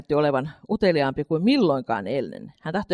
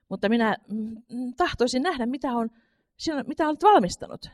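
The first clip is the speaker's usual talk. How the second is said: put-on little girl's voice; 7 semitones higher than usual. A narrator's reading. Katri pleads with mother.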